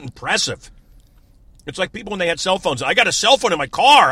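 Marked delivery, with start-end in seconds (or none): 2.8-4.1 high, loud smug bragging; mock a show-off; mocking brag.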